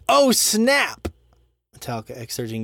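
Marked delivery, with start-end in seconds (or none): 0.1-1.1 high, punchy rock-star shout of oh snap. Retold or imitated. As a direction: belt it like a metal frontman. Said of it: "Metallica said".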